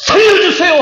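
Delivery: high, loud cry for help; desperate shout. Shown as a demonstration. Pastor shouting as a drowning man to congregation, desperate cry. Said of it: "고함을 치는 거예요".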